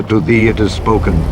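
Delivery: ordinary talk, nothing marked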